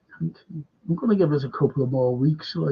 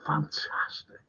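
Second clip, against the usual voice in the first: whispered awe; 11 dB quieter, slower than usual. Real, not acted. Awed praise of show.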